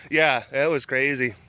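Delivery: ordinary talk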